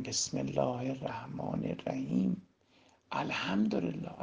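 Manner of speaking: very soft, slow prayer; hushed devotional recitation. Shown as a demonstration. Preacher shows listeners attentive prayer, hushed and slow. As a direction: recite quietly, with full attention.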